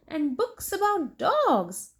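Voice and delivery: usual speaking voice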